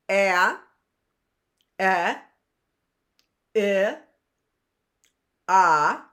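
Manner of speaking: short vowels sounded out, each alone; teacher sounding out vowels; short vowel sounds. Shown as a demonstration. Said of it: "as a short vowel, it says what?".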